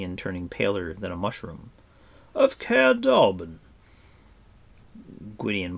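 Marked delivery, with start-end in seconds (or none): 2.3-3.6 slow, high, loud repeat in amazement; astonished echo. A narrator's reading. Gwydion astonished at the boy's origin.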